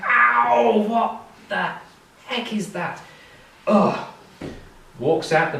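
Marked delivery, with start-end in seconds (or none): 0.0-4.0 pained, higher groan of a man with a hangover; groaning hungover mayor. Acted. Hungover mayor groans at the banging on the door. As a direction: wince and grumble at the noise.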